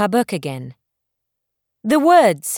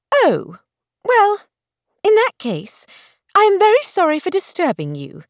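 higher, stiffly proud apology; haughty; 9 semitones higher than usual. Acted. Belle apologizing to the stranger, haughty and stiff. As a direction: apologize with your nose in the air. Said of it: "she said in a haughty voice".

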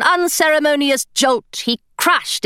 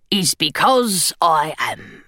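gargoyle voice; 6 semitones lower, slower than usual. Acted. Gargoyle character voice.

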